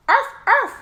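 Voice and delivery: high, loud yappy dog barks; barking. A narrator's reading. Sister Jujubee barks to mock Skippy, high and loud. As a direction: yap like a little dog. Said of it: "barked Jujubee".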